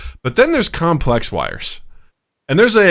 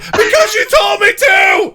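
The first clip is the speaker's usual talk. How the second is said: high, drawn-out exasperated shriek; mock freak-out; 3 dB louder, slower than usual. Teammate retelling a tutorial mishap, freaking out. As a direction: imitate a player losing it, shrieking. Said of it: "he was freaking out".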